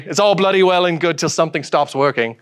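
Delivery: normal talking voice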